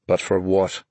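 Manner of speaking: understated, downcast; mildly dejected; glum question. A narrator's reading. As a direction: ask it glumly, deflated. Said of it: "Ben asked glumly".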